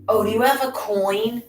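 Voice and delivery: high, put-on voice begging for a coin; mock whiny beggar. Mocking a street pest who begs for a coin, high put-on voice. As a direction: mimic the pest asking for money, pitched high.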